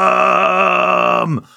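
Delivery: high, long sung-out yell of freedom; Braveheart freedom cry. Acted. [name] rallies the losing crowd, high sung battle yell. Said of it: "give a good".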